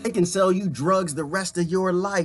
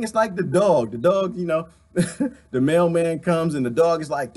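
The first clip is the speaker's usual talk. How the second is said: ordinary talk, nothing marked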